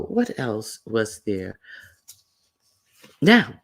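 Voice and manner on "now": sudden high loud call. Real, not acted. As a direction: bark it out to grab attention.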